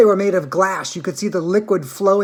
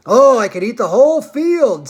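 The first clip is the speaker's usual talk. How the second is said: grinning delight, higher and louder; 5 semitones higher, 6 dB louder than usual. A narrator's reading. Grandpa Joe to Charlie, grinning with delight. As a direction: burst out with greedy joy. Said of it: "grinning with delight".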